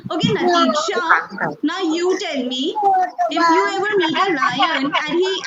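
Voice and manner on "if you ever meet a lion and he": ordinary delivery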